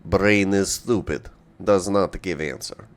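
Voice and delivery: put-on lightly held Russian accent; broken-English Russian accent. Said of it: "As to why I'm doing a Russian accent".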